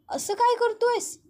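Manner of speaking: high, startled scolding; surprised chiding. Acted. Friend scolds Suyog for forgetting the trip, startled. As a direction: jump up in pitch, disbelieving.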